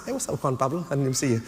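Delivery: normal talking voice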